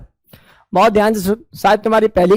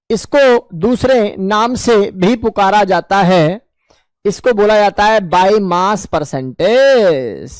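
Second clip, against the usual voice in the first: very slow, words stretched out; drawn-out classroom delivery; slow dictation; much slower than usual. Shown as a demonstration. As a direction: dictate the new name carefully. Teacher dictates new term slowly.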